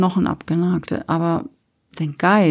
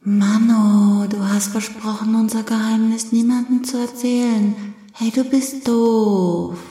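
put-on higher, whining child's complaint; sulky little girl; 5 semitones higher than usual. Child scolds friend over broken secret, high sulky whine. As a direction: pout and drag out the reproach.

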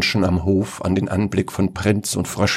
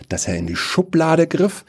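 ordinary delivery

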